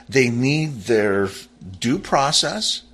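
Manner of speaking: ordinary talk, nothing marked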